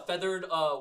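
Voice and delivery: ordinary talk, nothing marked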